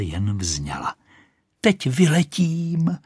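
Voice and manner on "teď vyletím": high, slow proclamation; triumphant cry. Acted. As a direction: cry out proudly before launching. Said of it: "křičela".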